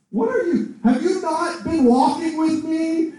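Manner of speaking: high-pitched, incredulous string of questions; offended Jesus. Acted. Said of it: "He's actually offended that they think".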